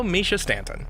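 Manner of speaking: ordinary talk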